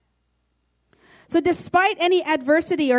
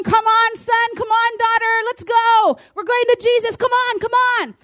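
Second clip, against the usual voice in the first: high, loud cheerleader call; rally them like a coach from the sideline; God as cheerleader urging his children on, excited; cheering on; 7 semitones higher, 3 dB louder than usual. Retold or imitated. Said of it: "He's there going".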